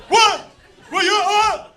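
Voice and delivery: high, loud offended brother; indignant brother. Retold or imitated. Brother insisting he'll eat the big half, indignant. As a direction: scoff like he's been insulted.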